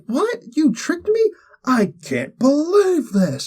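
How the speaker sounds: high, stunned outrage, slowing at the end; can't believe he was tricked; shocked disbelief. Retold or imitated. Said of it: "he's like".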